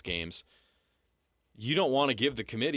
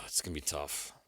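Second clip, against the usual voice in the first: sighing; 7 semitones lower, 9 dB quieter than usual. Real, not acted. Sighing over a tough schedule.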